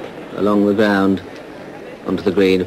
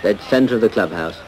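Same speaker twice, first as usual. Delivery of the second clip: ordinary delivery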